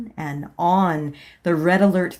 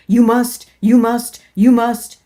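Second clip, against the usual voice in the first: loud, higher repeated demand; insistent command; 4 semitones higher, 10 dB louder than usual. Acted. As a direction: hammer the words home. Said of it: "strong current of a feeling".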